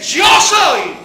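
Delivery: shouted proclamation. Acted. Preacher shouting Jesus's words to the congregation, full-voiced shout. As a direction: shout YO SOY at full force.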